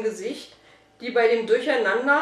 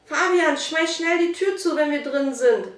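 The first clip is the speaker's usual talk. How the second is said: raised, urgent call; hurried shout; 6 semitones higher, 4 dB louder than usual. A narrator's reading. Boy orders his friend while rushing in, urgent shout. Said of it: "schrie Dominik in der Eile".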